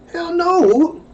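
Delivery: high, rising hell no; no rising up in disbelief; incredulous hell no. Retold or imitated. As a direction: say no like you can't believe it. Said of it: "This is what they say now".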